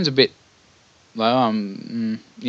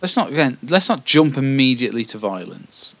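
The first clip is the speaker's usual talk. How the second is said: normal talking voice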